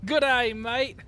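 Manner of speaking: high-pitched mock Aussie greeting; Australian accent. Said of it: "the best accent on Pilot Edge".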